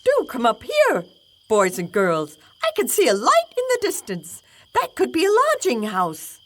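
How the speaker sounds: high, excited rooster calling down; eager rooster spotting a light. Acted. Rooster character voice.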